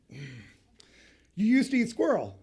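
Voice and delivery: slow, disbelieving you used to eat squirrel; incredulous question. Retold or imitated. Asking the woman in disbelief, slow incredulous question. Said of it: "I'm asking it as a question".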